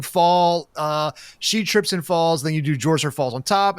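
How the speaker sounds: ordinary delivery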